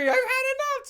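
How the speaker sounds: loud, high mock-angry wail; hammed-up furious crying shout. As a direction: yell it high, imitating an angry crier. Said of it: "like, an angry".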